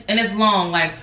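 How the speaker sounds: usual speaking voice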